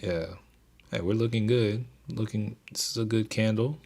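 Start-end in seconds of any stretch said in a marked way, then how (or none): none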